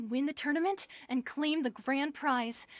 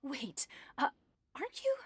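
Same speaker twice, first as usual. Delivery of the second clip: ordinary delivery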